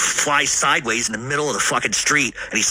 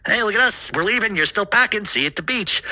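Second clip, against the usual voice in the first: higher, teasing sing-song gloat; mock taunting; 9 semitones higher than usual. Neighbors driving past teasing grandfather, higher gloating taunt. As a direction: rub it in like a smug neighbor.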